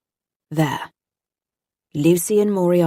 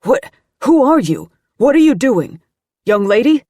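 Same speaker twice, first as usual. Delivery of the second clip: loud, higher, startled demand; alarmed challenge; 7 semitones higher, 7 dB louder than usual. A narrator's reading. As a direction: confront a stranger in alarm. Agent confronts sobbing intruder.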